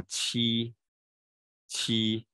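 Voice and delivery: 七 held long, air gliding over the tongue surface; drawn-out, clearly articulated 七; relaxed tongue-surface q. Shown as a demonstration. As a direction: model 七 slowly with a loose tongue. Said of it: "它其实也是舌头放松".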